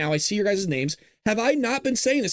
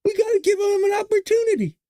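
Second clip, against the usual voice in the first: much higher, gushing mock voice, rising to a shout; mocking naive liberal; 11 semitones higher than usual.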